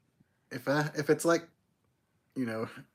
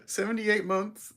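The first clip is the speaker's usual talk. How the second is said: mocking doting parent; 6 semitones higher, slower than usual. Mocking parents who count in months.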